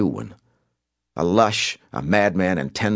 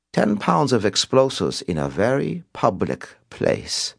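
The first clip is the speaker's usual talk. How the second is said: put-on slow, high-pitched European-accented menace; Simon, sinister; 7 semitones higher, slower than usual. A narrator's reading. Caller threatens a bombing to Cobb, sinister. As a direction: relish the threat, drawing it out. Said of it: "spoke in a low, sinister tone".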